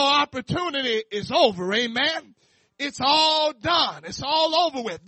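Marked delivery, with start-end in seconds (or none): none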